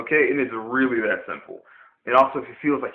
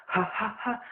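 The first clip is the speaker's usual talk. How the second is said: breathy taps; 9 semitones higher, 8 dB quieter than usual. Shown as a demonstration. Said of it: "it's not breathy".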